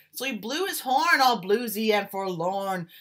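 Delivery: put-on lively rhythmic read-aloud, like reading to children; story narration. A narrator's reading. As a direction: swing the rhymes like a jazz storyteller. Reads picture book aloud.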